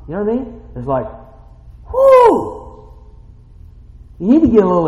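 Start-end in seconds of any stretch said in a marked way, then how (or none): 1.9-2.6 loud high joyful whoop; a big high celebratory whoo; excited whoo. Acted. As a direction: let out a praise cheer. Shows getting excited in praise.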